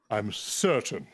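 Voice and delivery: slow, grand wizard voice; archmage. Acted. Reading a card as Archmage of the Storm Tower, slow pronouncement. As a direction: intone it like an archmage. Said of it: "Read it as the Archmage of the Storm Tower".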